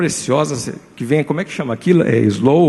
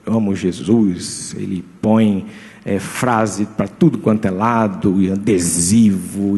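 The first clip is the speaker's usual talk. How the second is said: mock pious slogan voice; 7 semitones lower, slower than usual. Mocking showy gospel slogans.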